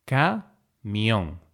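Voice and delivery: camión split into ca, mión; syllable by syllable. Shown as a demonstration. Teacher dividing camión for learners, syllable by syllable. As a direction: say "camión" with a pause after ca.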